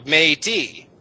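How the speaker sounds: "matey" drawn out slowly, two clear syllables. Shown as a demonstration. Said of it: "there's still the, uh, other syllable".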